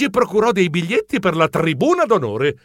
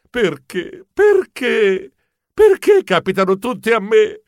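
mock-despairing wail, building louder; sighed lament; 5 semitones higher, 4 dB louder, slower than usual. Geronimo missed his idol, sighing lament that builds. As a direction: bemoan your luck, rising on each why.

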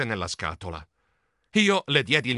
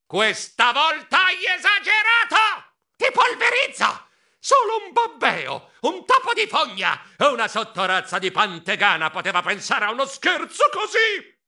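furious shriek; 11 semitones higher, 4 dB louder than usual. Acted. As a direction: scream out the outrage. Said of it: "strillai a mio cugino a voce altissima".

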